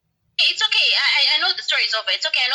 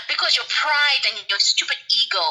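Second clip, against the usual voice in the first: usual speaking voice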